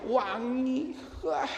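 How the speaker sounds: high-pitched teasing admiration; kagum menggoda. Retold or imitated. Praises grown daughter's long bath. (pujian menggoda bernada tinggi)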